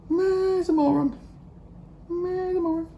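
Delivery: very high-pitched squeaky jeer; a tiny, silly voice passing judgement; mocking silly voice. Vlogger jeering at people who deny having an accent, high mocking squeak.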